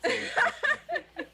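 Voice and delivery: squealing laugh through words. Real, not acted. Player amazed at his own accent, laughing. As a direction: laugh it out in disbelief.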